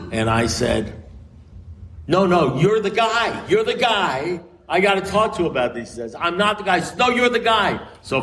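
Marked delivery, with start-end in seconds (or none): 2.1-7.8 pitched way up, insistent back-and-forth; insisting. Retold or imitated. Lawyer insisting to the priest, pitched up high and pleading. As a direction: keep pushing him higher each time.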